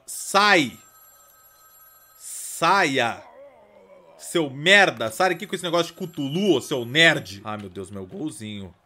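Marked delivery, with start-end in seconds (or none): 0.1-7.4 loud angry yells; a furious dad throwing out a Cthulhu cultist; shouting someone out. Pretend. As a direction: drive the intruder out. Chasing out cultist visitor.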